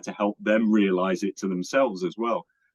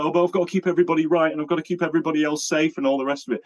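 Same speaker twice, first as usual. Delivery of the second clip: mocking quote; 8 semitones higher, faster than usual. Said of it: "Everyone's like".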